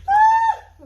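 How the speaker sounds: very high piercing shriek; disgusted scream. Real, not acted. Reacting to disgusting bean.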